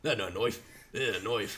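low, soft mock Australian; butchered Australian accent. Friend quoting their running joke, bad Australian accent. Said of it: "completely butcher Australian accents".

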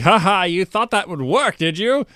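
high, loud gloating taunt; mock gloating Batman. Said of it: "He's like".

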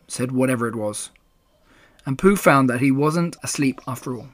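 plain reading voice

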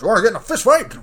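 put-on high, loud old-timey challenge; mock challenge. Challenging the driver to a fist fight, hammed-up. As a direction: square up like a 1914 brawler.

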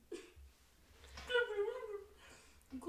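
gurgle out like a fish; gurgling fish man croak. Pretend. Playing Mister Fish.

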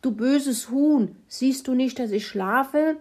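high, loud scolding; cross, indignant pig outburst; angry grunting pig. Acted. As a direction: scold the hen like a pig robbed of sleep. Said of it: "als Kalle schon wieder losgrunzte".

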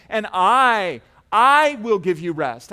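"I" hit loud and held long, then repeated; loud, drawn-out stress on "I" in a preacher's declaration; emphatic I. Acted. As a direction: punch the word "I" with full force, pause, punch it harder. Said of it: "It's so emphatic, Jesus saying".

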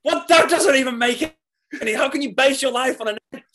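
high, loud, fast retold incredulity; baffled disbelief. Reacting to a friend's botched stage line, baffled shriek. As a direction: squeak out the outrage at the absurd line. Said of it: "And I was like".

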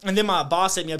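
usual speaking voice

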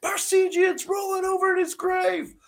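very high strained fan yell; high-pitched mock scream; screaming heckler. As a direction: imitate a ranting fan screaming from the stands. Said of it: "screaming at the top of his lungs".

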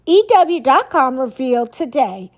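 usual speaking voice

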